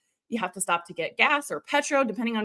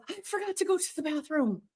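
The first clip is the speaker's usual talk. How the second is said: put-on sheepish kid's confession; 5 semitones higher, 8 dB quieter than usual. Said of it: "somebody goes".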